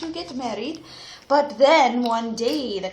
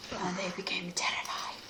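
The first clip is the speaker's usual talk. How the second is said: whispered, fearful narration; hushed suspense; 6 semitones lower, 13 dB quieter than usual. A narrator's reading.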